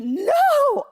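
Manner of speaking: a very high, loud cry of No; anguished scream. Retold or imitated. At the moment of reality, a very high loud scream. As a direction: let out one raw scream. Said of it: "and I screamed".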